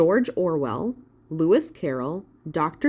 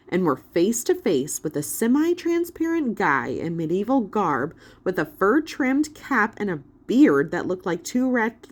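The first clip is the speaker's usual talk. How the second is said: usual speaking voice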